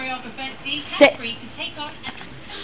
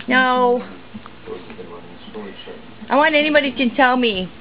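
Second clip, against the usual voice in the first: ordinary talk, nothing marked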